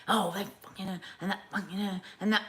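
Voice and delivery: imitated negative swearing. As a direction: imitate a sour, muttering swearer. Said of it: "I find it aggressive and, like, negative".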